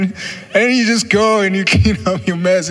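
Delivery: chuckling at the memory; laughing. Real, not acted. Laughing while retelling relief.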